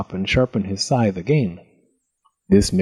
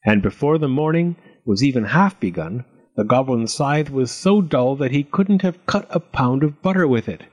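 usual speaking voice

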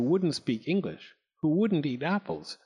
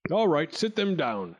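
jolly laughing lord; 8 semitones higher than usual. Acted. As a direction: laugh it out heartily. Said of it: "bellowed with laughter".

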